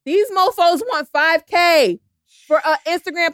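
high, loud, drawn-out outrage at the price; loud exclamation. Real, not acted. Co-host revealing an outrageous ad price to friends, loud exclamation. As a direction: belt it out slowly, pitch way up.

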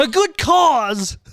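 high, carefree mock Becca voice, not caring at all; a defiant, devil-may-care voice pitched high; a hint of a wild driver who won't brake; reckless Becca. Voicing Becca's reckless retort.

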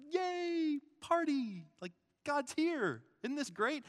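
high-pitched celebratory cheering, drawn out; excited cheer. Acted. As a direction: cheer like the party has started. Acting out joy at God's coming.

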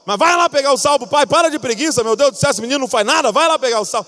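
high, loud nagging dad, fast in the middle; fed-up father ordering his son around; scolding father. Retold or imitated. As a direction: lecture the lazy kid to get up and go.

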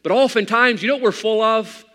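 loud, high preacher's build-up; fiery preaching. Acted. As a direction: drive the question home from the pulpit. Preaching at full force.

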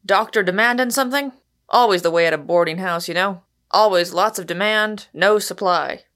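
loud, quick-fire joker; cheeky, jovial boarding-house lodger; brash newcomer, uproarious. Acted. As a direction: rattle it off like a boisterous wag.

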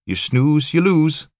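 high, loud grinning taunt; cocky Highland quip. Acted. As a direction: rub it in with a grin. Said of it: "Cocky and ambitious with it".

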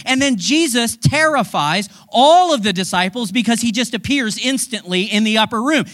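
usual speaking voice

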